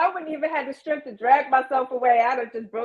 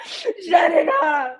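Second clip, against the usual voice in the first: drawn-out melodramatic cry, pitched up; dramatic outburst; 4 semitones higher, 3 dB louder, much slower than usual. Acted. Emotional guest acting out her on-camera meltdown, dramatic. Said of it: "I'll be all dramatic".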